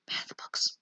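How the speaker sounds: whispered aside. Real, not acted.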